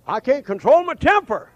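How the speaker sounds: high, loud quoted outburst; acted-out exasperated protest; mimicked complaint. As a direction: cry it out as a worked-up excuse. Acts out a complainer's excuse.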